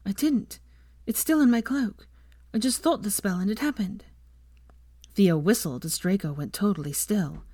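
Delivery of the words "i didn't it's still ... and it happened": high, unsettled small voice; shaken. A narrator's reading. Hermione explains her wandless spell to Ginny, shaken. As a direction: explain it while still rattled, pitched up. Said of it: "still shaking when she said".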